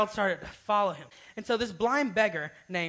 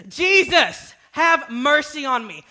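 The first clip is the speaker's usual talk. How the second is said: high, loud desperate cry, slow at the end; screaming for mercy; 8 semitones higher, 12 dB louder, much slower than usual. Retold or imitated. Beggar screaming to Jesus past the crowd, loud and desperate. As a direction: scream his name to be heard. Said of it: "He kept screaming this".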